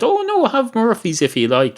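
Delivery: high-pitched barman butting in; lively, high-pitched interjection in a put-on dialogue; eager protest. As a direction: jump in high to talk them round. Barman protesting in acted joke dialogue.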